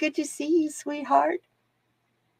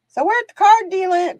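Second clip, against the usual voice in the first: "card dealing" called out much higher and louder; sing-song announcement; 11 semitones higher, 8 dB louder than usual. Acted.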